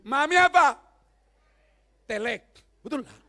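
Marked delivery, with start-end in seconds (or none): 0.1-0.8 put-on loud, higher offended child voice; a sulky child snapping back.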